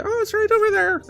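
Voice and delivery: very high falsetto character voice; put-on high dwarf voice; squeaky dwarf. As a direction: give directions in a tiny high-pitched voice. Game master voices a helpful dwarf.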